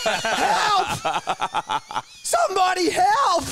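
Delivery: loud, high-pitched call for rescue; re-enacted desperate shouting; yelled cry for help. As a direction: holler to people far up the street. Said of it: "I, I yelled out".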